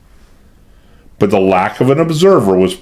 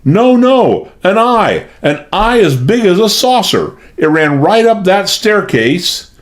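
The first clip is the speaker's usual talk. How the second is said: terrified; 10 semitones higher than usual. A narrator's reading. Frightened maid insisting to her mistress, high panic. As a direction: babble in terror, voice up high.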